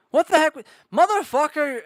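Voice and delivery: usual speaking voice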